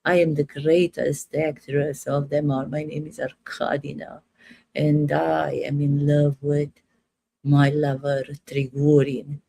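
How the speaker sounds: put-on low, grand Russian-accented stage diva. Actress declaring love for Trigorin, low Russian accent. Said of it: "When I do an accent".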